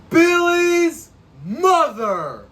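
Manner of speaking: loud, high, slowly stretched-out announcement; hammed-up dramatic reveal. Reviewer unveiling the surprise killer to viewers, hammed-up dramatic reveal. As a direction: belt it out like an over-the-top twist reveal. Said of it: "Yes, I had to do that".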